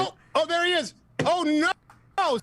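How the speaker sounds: real high-pitched alarmed "oh no"; genuine dismay. Real, not acted. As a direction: voice leaps up in dismay. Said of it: "That was the most genuine".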